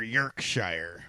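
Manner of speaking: Yorkshire drawn out as "York-shy-er"; playful mispronunciation. Pretend. American host teasing his British co-host, mispronouncing Yorkshire. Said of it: "No, don't talk like that".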